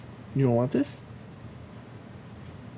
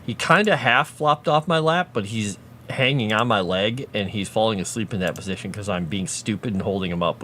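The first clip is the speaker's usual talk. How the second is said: normal talking voice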